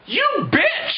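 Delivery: very high, squealed insult; hammed-up outraged cry, pitch leaping; mock outrage. Heroes learn it's already done, mock outrage.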